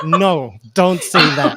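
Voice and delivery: loud, mock-urgent veto; alarmed objection. Wife stopping husband from admitting the truth, alarmed objection. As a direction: cut him off with a loud retold "no".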